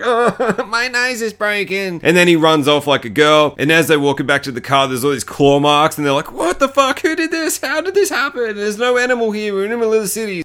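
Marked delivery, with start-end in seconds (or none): 0.0-2.0 mock crying. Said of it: "he was kinda crying". 6.3-10.4 very high mock panic over the claw marks; panicked friends.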